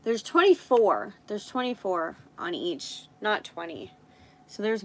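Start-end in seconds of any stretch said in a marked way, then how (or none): 0.0-1.1 real surprise, louder; surprised. Real, not acted. Finding more nail tips than she thought, surprised. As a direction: blurt it out, caught off guard. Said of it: "oh my gosh".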